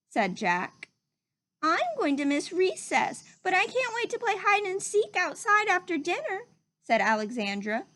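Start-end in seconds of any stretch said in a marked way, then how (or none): 1.6-6.5 put-on high, eager kid's voice; excited child. A narrator's reading. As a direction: bounce with summer excitement.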